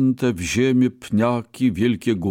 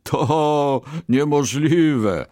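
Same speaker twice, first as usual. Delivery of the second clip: high, drawn-out scoff with a touch of amusement; laughing disbelief; 6 semitones higher, 3 dB louder, much slower than usual. A narrator's reading. Merchant laughs off the Brahmin's boast, amused. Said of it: "zaśmiał się kupiec".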